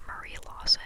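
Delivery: plain reading voice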